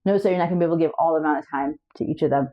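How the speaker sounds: usual speaking voice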